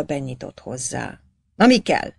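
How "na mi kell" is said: loud, higher barked question; gruff snap. A narrator's reading. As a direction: snap it from behind the desk. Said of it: "recsent rá". (ráförmedő, nyers kérdés)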